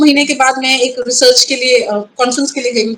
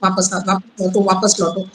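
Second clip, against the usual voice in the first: low, soft, insistent urging; imitated male voice; 6 semitones lower, 5 dB quieter than usual. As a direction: quietly imitate a man's voice calling you back. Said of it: "constantly मेल voice मेरे कान में बोलती". (नीची, धीमी मर्दाना आवाज़ की नकल)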